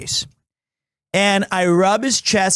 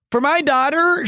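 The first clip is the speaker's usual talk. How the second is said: ordinary talk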